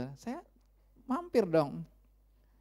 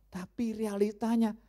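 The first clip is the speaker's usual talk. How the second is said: ordinary delivery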